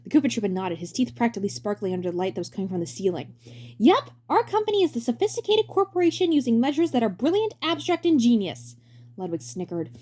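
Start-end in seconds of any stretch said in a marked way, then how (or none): 3.8-8.8 bright high showman voice; high cheery director voice. Acted. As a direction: play the grinning director, way up high. Director pitching the company.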